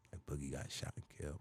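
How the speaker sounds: very soft, subdued retelling; barely able to talk. Retold or imitated. Telling his mom his friend was killed, hushed and heavy. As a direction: break the news almost under your breath. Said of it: "I can't really talk".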